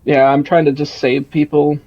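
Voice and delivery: booming, self-important joking; loud mock boast. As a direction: project it like a showman. Boasting loudly after loudness jokes.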